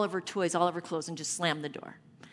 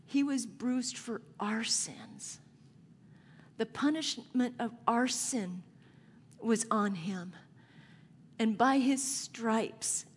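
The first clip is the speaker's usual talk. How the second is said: slow, whispered sermon climax; hushed preaching; 3 semitones higher than usual. Acted.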